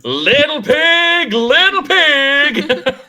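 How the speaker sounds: high, loud, drawn-out sing-song taunt; taunting bandit call. Acted. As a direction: call out slowly like a bandit hunting prey. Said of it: "bandits just enter the clearing like".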